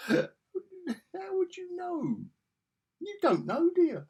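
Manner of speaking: slow, high, drawn-out mockery; sarcastic. Real, not acted. Reviewer ridicules Fury's question, slow and high. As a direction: sneer at the plot hole. Mocking the character's logic.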